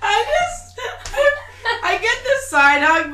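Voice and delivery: high, loud helpless laughter; laughing hard. Real, not acted. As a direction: crack up mid-sentence. Cracking up at the story.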